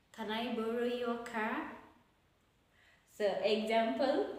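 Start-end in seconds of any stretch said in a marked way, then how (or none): none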